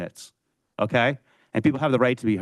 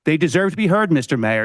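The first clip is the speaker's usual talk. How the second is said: raised voice, driving the words home; louder into the mic; 5 dB louder than usual. Real, not acted. Resident at council meeting demanding the mayor listen, louder. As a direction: repeat it louder so it carries over the mic. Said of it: "Is this microphone on?".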